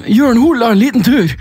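high put-on voice; playful high-pitched imitation. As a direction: mimic the singer up high. Imitating singer [name].

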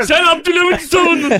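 high, shouted comeback; shouted accusation. Real, not acted. Panelist accusing his opponent in a quarrel, shouting.

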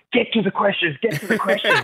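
a frantic producer, pleading, half laughing; mock urgent producer. Host imitating his producer's texts, high and laughing. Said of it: "My producer's texting me going".

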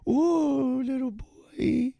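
high squeaky cat voice. Shown as a demonstration. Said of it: "It's my cat voice".

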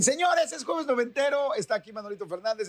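high, hyped show opener; radio announcer. Acted. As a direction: sell it like a radio host opening. Radio host opens segment. (presentador de radio animado)

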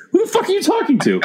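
high-pitched, laughing mock disbelief; incredulous outburst. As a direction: blurt it out way up high, baffled and amused. Acted passer-by baffled by grave talk.

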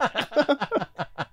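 a high, bright burst of laughter. Real, not acted. Friend laughs at the idea of a fake name, laughing high. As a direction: laugh up high at your own joke.

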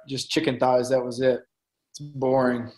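ordinary delivery

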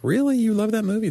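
incredulous, as if it can't be true; surprised disbelief. Retold or imitated. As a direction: jump high on "Really?" in mock amazement. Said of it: "I'm like".

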